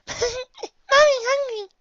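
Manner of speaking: put-on high, loud giggly kid voice; giggle, then tease in a high voice; laughing child. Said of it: "I laugh".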